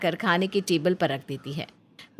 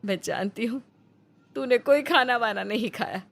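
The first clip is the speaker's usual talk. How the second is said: slightly higher, tender mother urging her son to eat; mother's gentle coaxing voice; 4 semitones higher than usual. Acted. Mother Nandita coaxing her hungry son to eat, tender. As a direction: coax him like a caring mother who knows he's hungry.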